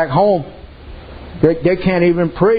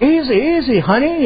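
mock begging husband; 7 semitones higher than usual. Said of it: "he's kind of begging".

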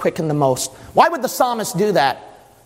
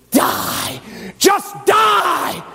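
rasped cry rising high; commanding devil; 12 semitones higher, much slower than usual. Acted. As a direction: throw out the tempter's order forcefully. Devil speaks in the mind.

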